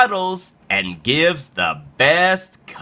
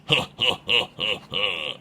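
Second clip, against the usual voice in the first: low, pulsing bully chuckle; mock-evil laugh; villain's laugh; 3 semitones lower, 6 dB quieter than usual.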